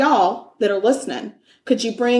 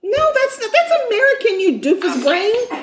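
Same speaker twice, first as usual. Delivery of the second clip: mock outrage; 12 semitones higher than usual. Mock outrage at the search result.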